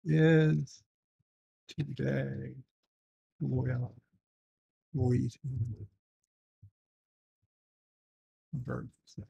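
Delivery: soft, drawn out word by word; slow trailing mumble. Real, not acted. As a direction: mumble it slowly while typing.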